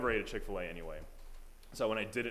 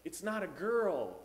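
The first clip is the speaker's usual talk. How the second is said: son's reply; 6 semitones higher, slower than usual. Retold or imitated. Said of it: "the guy was like".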